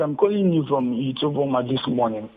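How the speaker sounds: ordinary delivery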